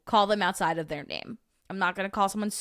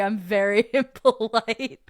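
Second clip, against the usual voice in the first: louder, slowed by laughter; laughing; 3 semitones higher, 6 dB louder than usual. Real, not acted. Retelling what they taught a friend, laughing. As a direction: laugh your way through the words.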